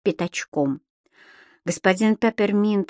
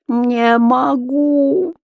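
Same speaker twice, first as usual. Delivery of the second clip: long, drawn-out higher moan; groaning; 5 semitones higher, 4 dB louder, much slower than usual. A narrator's reading. Tenant answers the landlady's threat, groaning. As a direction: groan the words helplessly, very slowly. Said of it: "простонал в ответ господин Пепперминт".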